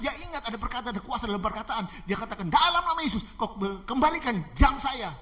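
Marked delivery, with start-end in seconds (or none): 2.5-5.1 commanding. Acted. Pastor ordering robber to return his watch, commanding. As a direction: proclaim it with authority.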